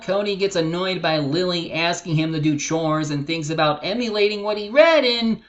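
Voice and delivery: ordinary delivery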